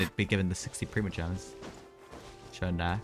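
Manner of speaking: ordinary delivery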